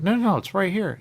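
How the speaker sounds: loud and high, falling over himself to help; an owner desperate to make the sale; eager owner. Retold or imitated. The owner insisting to a customer, loud and high.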